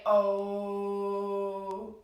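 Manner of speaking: a low, held open Oh; round O sound. Shown as a demonstration. Teacher showing children how to make an O sound. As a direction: hold a round Oh like a hum. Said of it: "Can you say".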